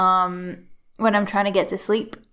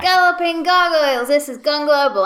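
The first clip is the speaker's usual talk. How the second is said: loud, higher announcer opener; show intro; 6 semitones higher, 6 dB louder than usual. Real, not acted. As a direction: launch the show with gusto.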